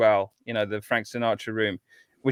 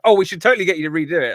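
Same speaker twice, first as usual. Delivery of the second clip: quick, higher and louder quote; mock eager suggestion; 5 semitones higher, 8 dB louder, much faster than usual. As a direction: imitate their keen pitch in a rush. Acts out filmmakers' eager suggestion.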